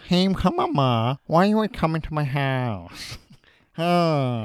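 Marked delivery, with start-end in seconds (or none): 0.1-3.2 loud, drawn-out nasal whine; nasal and whiny. Acted. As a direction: whine through the nose in an impression. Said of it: "he's a bit nasally and whiny as well".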